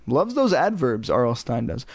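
usual speaking voice

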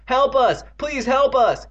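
high, loud desperate plea; crying out. A narrator's reading. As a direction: scream for rescue while struggling. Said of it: "Both brothers cried out".

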